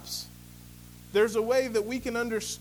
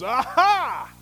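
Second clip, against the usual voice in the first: loud, high exclamation of discovery; storyteller's inspector exclaiming loudly; triumphant aha; 7 semitones higher, 10 dB louder than usual. Retold or imitated. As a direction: crow it out like you caught them. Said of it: "the inspector goes".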